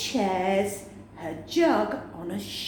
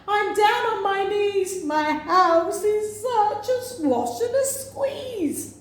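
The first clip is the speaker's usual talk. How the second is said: crying out; 10 semitones higher than usual. Acted. Old woman crying out in despair, high and sing-song. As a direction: wail it half singing.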